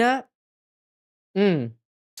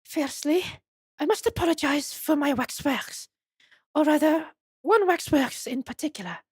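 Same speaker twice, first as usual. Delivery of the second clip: put-on high French-accented madame; Esmeralda Tuspels; 10 semitones higher than usual. Madame apologizes to visitors for her waxwork, high French accent. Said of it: "Okay, it's French".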